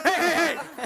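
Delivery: high, loud calls to stop; loud hey. Real, not acted. Presenter loudly calling to stop the lion volunteer. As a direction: call out to stop the chase.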